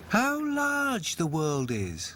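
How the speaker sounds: slow, high wondering voice; young ducks marvelling. A narrator's reading. Ducklings marvel at the world, slow high wonder. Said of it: "said the young ducks".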